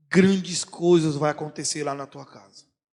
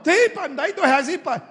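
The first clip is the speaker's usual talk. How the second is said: put-on pleading child; 11 semitones higher, 6 dB louder than usual. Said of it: "Aí tu".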